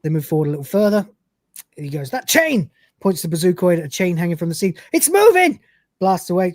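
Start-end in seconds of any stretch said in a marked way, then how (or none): 2.1-2.7 startled cry. Acted. Lister spots chain, panics. 4.9-5.6 high, loud alarmed yell; a nervous gunman seeing movement; panicked shout. Acted. Lister panics at chain.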